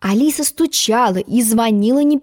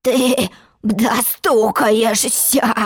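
very slow, hoarse croak; croaking frog promise; much slower than usual. Acted. Frog warning Alice about knocking, slow hoarse croak. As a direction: stretch it into a froggy rasp. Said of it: "пообещал лягушатище".

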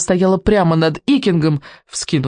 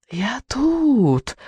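high, teasing singsong; singsong witch; 7 semitones higher, 3 dB quieter than usual. A narrator's reading. Witch taunts the boy from the dark, singsong. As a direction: sing it out, pitch gliding up high. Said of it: "пропел ведьмин голос".

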